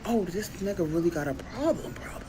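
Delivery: breathy whisper to herself; whispered aside. Retold or imitated. Girlfriend whispering aside while his mother talks. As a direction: whisper it so he won't hear.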